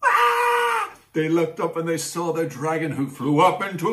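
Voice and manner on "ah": high loud scared scream; child's scream. Acted. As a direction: shriek in terror like a kid. Boys scream at dragon.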